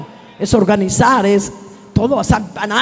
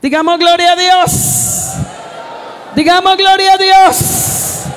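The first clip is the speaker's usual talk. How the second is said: preacher's call to praise; 8 semitones higher than usual. Acted. Pastor inviting the congregation to glorify God, high drawn call. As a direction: stretch "Digamos gloria a Dios" out high as an invitation.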